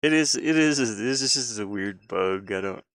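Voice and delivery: usual speaking voice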